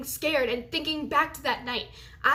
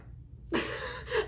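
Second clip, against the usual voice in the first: disbelieving laugh; 11 semitones higher, 5 dB quieter than usual. Real, not acted.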